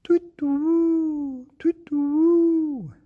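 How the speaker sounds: very high, loud hooting; owl hoot. Acted. Narrator voicing the owl in the garden, high hooting. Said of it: "went the owl".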